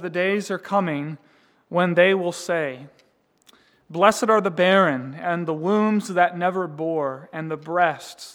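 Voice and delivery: solemn Gospel reading, rising to loud proclamation; preacher reading Luke aloud at full force; scripture reading. Acted. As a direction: read the scripture as a forceful sermon. Reads Jesus' words from Luke.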